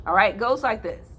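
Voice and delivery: ordinary delivery, nothing marked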